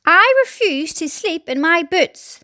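high, slow, emphatic refusal; defiant cry. A narrator's reading. Centipede objecting at bedtime, crying out defiantly. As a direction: refuse it word by word. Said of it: "the centipede cried".

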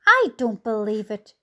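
ordinary delivery

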